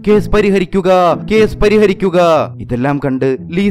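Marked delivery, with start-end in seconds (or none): 0.1-2.2 high, loud chanted shout; street vendor shouting. A narrator's reading. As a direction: yell the slogan like a street hawker. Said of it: "ഒച്ചയെടുക്കാൻ തുടങ്ങി".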